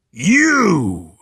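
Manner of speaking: loud growling monster answer; bare your teeth and shout it; monstrous shout. Acted. Swamp creatures answering what's for dinner, shouting.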